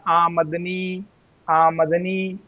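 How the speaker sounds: आमदनी slowly as आ-मद-नी, long final नी; joined correct pronunciation. Shown as a demonstration. Teacher modelling the built word, आमदनी slowly as आमद-नी. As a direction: draw out आमदनी with a vowel after म. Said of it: "एक साथ पढ़िए".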